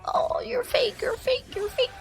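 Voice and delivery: high, airy mimicking voice; mocking accusers. Imitating people who call her fake, mocking. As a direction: taunt in a thin, high mock voice.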